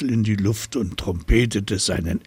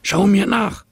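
high, louder monkey call; chattering monkey; 11 semitones higher, 6 dB louder than usual. A narrator's reading. Monkey calling to the giraffe from the branches, high. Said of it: "schnatterte er".

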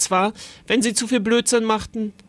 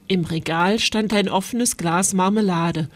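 plain reading voice

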